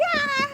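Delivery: very high scream; a high far-off squeal; distant scream. Acted. GM voicing another goblin, a very high squeal. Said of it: "And you hear, like".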